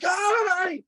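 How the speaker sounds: very high, mock-giddy hello; excited greeting. Kid and dad waving back at the Ghostbuster, high giddy imitation. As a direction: imitate two starstruck fans calling out in a squeaky voice.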